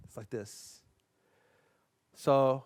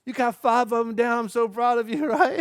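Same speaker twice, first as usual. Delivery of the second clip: higher and louder, delighted; excited praise; 8 semitones higher, 8 dB louder than usual. Real, not acted. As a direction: cheer them on with a laugh. Praising kids' progress.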